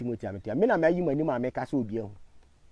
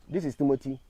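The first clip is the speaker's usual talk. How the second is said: usual speaking voice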